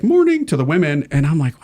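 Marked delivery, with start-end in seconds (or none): 0.0-0.5 high lilting morning; sing-song greeting. Retold or imitated. Hippie priest greeting the women, high and sing-song.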